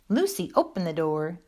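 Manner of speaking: plain reading voice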